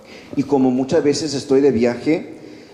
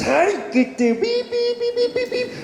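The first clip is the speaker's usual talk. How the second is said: squeaky, much higher stuttering vi vi vi; mock outburst. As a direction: spit it out like a panicked cartoon. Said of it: "Muchas veces mi reacción es". (arrebato burlón, voz muchísimo más aguda y entrecortada)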